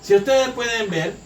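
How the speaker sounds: normal talking voice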